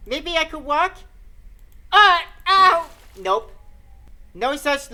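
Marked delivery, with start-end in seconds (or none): 1.9-2.9 sharp high yelp of pain; a sudden loud ow as weight hits the sprain. Acted. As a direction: cry out as the bad ankle gives. Ankle pain when trying to stand.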